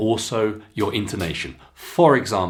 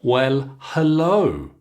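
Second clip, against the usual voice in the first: usual speaking voice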